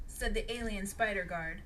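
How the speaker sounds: ordinary delivery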